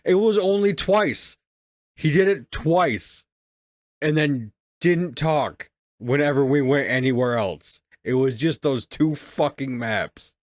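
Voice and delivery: loud, high and emphatic; exasperated protest. Real, not acted. Gamer arguing with friend over Claptrap's outbursts, exasperated. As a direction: raise your voice and insist, stretching the key words.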